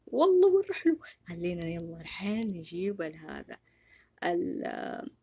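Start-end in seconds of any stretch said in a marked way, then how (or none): 0.1-1.0 high, loud delight. Real, not acted. Gamer showing viewers his avatar, gushing loudly. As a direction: gush over how good it looks.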